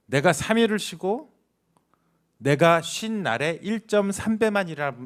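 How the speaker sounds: ordinary talk, nothing marked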